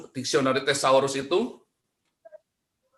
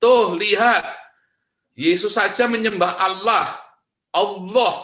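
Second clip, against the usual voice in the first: loud, high mock-triumphant claim; claimant's quote; 7 semitones higher, 6 dB louder, slower than usual. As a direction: crow it like an opponent pointing out proof. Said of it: "akan mengklaim".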